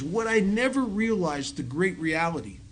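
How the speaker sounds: usual speaking voice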